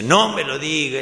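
acted loud objection; exasperated outburst. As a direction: cry out in protest from the booth. Hidden voice objects, now louder.

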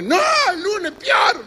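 hammed-up shout of the technician; shouted refusal. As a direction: yell the punchline high and slow.

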